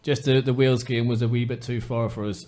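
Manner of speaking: usual speaking voice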